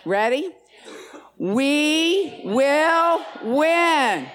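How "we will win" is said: high, loud, slow cry of "We will win"; stretched battle cry leading a crowd; group declaration shout. Real, not acted. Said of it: "let's just declare this".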